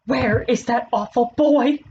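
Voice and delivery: mild scream. A narrator's reading. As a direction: call out slowly, without full force. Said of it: "screamed Missus Pig".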